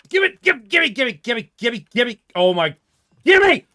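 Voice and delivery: high, loud, rapid begging; snatch at it with your voice, desperate; frantic pleading. Real, not acted. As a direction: gamer scrambling for loot, frantic. Grabbing for loot in game.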